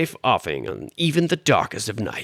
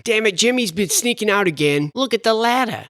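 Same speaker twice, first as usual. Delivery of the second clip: normal talking voice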